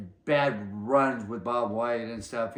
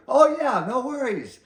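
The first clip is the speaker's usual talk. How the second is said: high, loud carefree yes; breezy assurance; 11 semitones higher, 6 dB louder than usual. Retold or imitated. Fred brushing off splitting the bet money. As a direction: brush off the worry with a grin. Fred's breezy reassurance retold.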